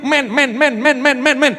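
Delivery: mock repetitive begging; whining small child. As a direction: pester on and on like a little kid. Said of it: "nggak akan berhenti".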